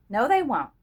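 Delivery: firm, combative denial; belligerent. A narrator's reading. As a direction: push back hard. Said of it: "Henchner denied belligerently".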